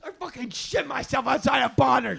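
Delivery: high, shouted admission with laughter; exasperated confession. Retold or imitated. Finally confessing to Brooke in the car, shouted in exasperation. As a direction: act out finally blurting it loudly.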